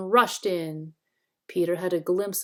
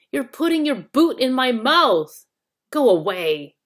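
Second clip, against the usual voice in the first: loud angry complaint; bellowing; 5 semitones higher, 8 dB louder than usual. A narrator's reading. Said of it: "bellowed Trumpkin".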